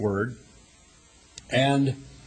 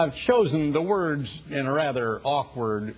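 usual speaking voice

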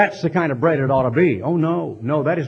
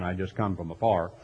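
lower, softer mock fireman saying "afar"; fireman impression; 7 semitones lower, 8 dB quieter than usual. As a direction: drop into a low, soft joke voice of the fireman. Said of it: "like the famous noonday volunteer fireman".

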